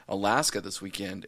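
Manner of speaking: ordinary delivery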